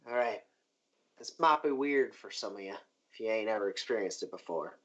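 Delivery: lightly voiced character, low and soft; Cho's low-key warning. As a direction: give a low, understated heads-up. Game master voices Cho.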